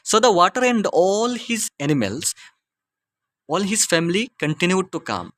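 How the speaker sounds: slow, measured classroom story reading; deliberate teacher's reading pace; slow read-aloud. Acted. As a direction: read it out slowly, phrase by phrase for learners. Reads the story line aloud.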